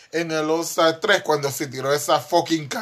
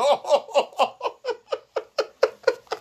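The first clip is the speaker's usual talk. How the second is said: risa aguda que se ahoga en tos; reír hasta toser; risa con tos. Real, not acted. (risa ahogada con tos)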